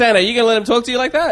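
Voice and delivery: high, fast laughing taunt. Pretend. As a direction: egg him on while cracking up. Goading Santa through laughter.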